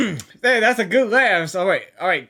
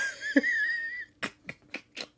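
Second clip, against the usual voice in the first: a very high, breathless burst of laughter; real laughing fit; high squeal of laughter; 13 dB quieter than usual. Real, not acted. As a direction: lose it at the joke. Laughing at the nicknames.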